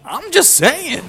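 high, loud mock whine of protest; indignant protest. Guest defends himself to the queen, squeaky indignant protest. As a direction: protest way up high, playing the wronged party. Retold protest at being scolded.

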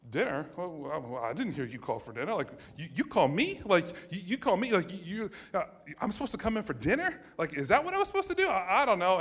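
high, stammering mock surprise; feigned innocence. Kid pretends to mom he heard nothing, feigning surprise. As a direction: play dumb, pitch climbing with each question.

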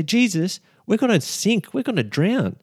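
high, frantic cry of sinking men; panicked disciples. Acted. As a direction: plead in panic over the storm. Disciples panicking, waking Jesus.

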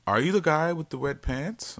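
man imitating his date, high and curious; high mock girl voice. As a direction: voice her high and questioning. Voicing the date's question.

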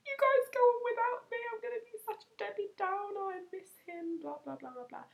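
imitated tearful whine, starting very high and sinking; mock crying. Telling friends to go out without her, mock crying. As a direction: put on a weepy wail that dwindles away. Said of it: "start crying at Prees and being like".

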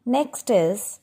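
ordinary talk, nothing marked

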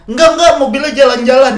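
high, loud mock-drunk denial; orang mabok. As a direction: imitate a drunk protesting. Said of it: "Anjing, dia mabok". (meniru orang mabok, nada tinggi)

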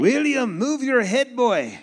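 loud, high-pitched Scottish movie impression; comic Scottish shout; Scottish accent. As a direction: holler it like a Scot quoting a film. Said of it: "learned how to speak a little Scottish".

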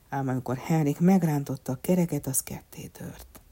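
usual speaking voice

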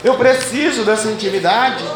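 loud, pleading prayer to God; fervent prayer. Acted. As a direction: pray out with urgency. Pastor praying at force.

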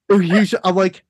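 ordinary talk

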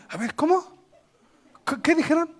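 high-pitched, curious questioning; hammed-up, high "what did they say?" curiosity; nosy eavesdropper. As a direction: mimic a busybody straining to hear gossip. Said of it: "¿Sí o no hacen esa expresión?".

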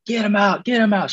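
high, yelled get him out; imitating a screaming head coach; retold coach scream. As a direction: bark it like an angry coach on the field. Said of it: "screaming, right?".

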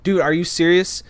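high-pitched exasperated protest; furious. Retold or imitated. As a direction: point out the obvious, incensed. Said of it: "So I'm furious".

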